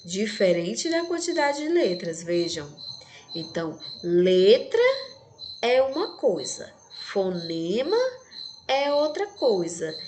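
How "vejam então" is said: ordinary talk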